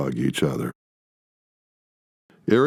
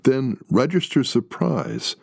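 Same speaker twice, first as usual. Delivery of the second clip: ordinary delivery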